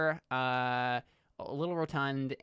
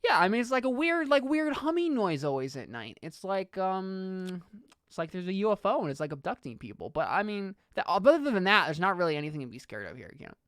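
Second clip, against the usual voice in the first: higher, rambling camper explaining rumours; lightly voiced talkative kid; chatty kid Paul; 6 semitones higher than usual. Plays Paul.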